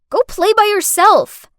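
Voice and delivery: high, loud, annoyed yell; shouted dismissal. Acted. Carer sends the pestering girl off, shouting. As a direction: shout the kid away. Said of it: "I shouted".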